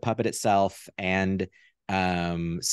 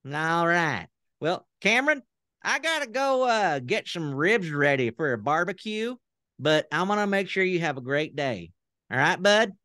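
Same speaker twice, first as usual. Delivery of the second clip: much higher folksy puppet voice greeting the host; Southern puppet character; 9 semitones higher than usual. Pretend. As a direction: play the friendly talk-show puppet. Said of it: "I'll try him on".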